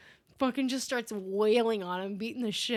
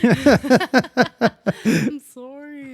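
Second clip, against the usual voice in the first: laughing mock apology; 3 semitones higher, 11 dB louder, much slower than usual.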